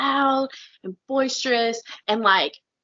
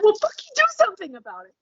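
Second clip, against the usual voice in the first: much higher, fast snap; exasperated retort; 10 semitones higher, faster than usual. Retold or imitated. Fed up with lazy complainers, a fast high snap. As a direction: fire it back, fed up. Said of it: "I'm like".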